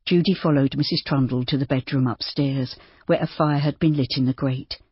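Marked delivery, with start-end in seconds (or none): none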